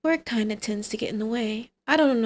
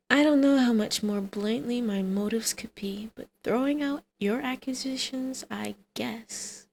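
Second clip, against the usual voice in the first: whisper. Acted. Flirty villain monologue.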